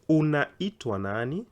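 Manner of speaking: "Unaitwa nani?" slowly, with a rising question ending; slow for learners. Shown as a demonstration. Teacher introducing a Swahili question, slow and rising. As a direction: stretch out "Unaitwa nani?" syllable by syllable, ending high. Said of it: "I'm trying to go slow".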